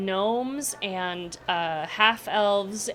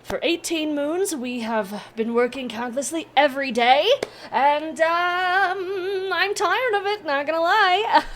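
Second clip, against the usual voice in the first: high, loud weary whine; tired gnome; 9 semitones higher, 5 dB louder than usual. Acted. Gnome admitting to the party he's worn out, high whine. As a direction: confess exhaustion dramatically.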